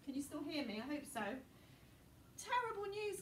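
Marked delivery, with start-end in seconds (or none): 2.4-3.2 high, breathless gasp of alarm; a schoolgirl blurting terrible news; Hermione gasping. Acted. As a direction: gasp it out like bad news just arrived. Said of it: "gasped Hermione".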